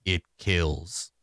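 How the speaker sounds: low, quiet, grave two words; solemn Clonk. Acted. Clonk speaking for the first time to the party, solemn and low. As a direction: drop it like a final verdict.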